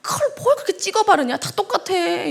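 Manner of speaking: a grumbling husband's sneer, laughing through it; mock nagging husband. Husband mocking his wife's makeup, half-laughing grumble. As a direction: scoff at her makeup like a grumpy husband.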